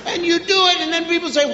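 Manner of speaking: ordinary talk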